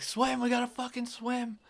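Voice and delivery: high, strained imitation of a friend calling out; urgent yell. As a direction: mimic a panicked swimmer urging escape. Retells friend urging him to swim.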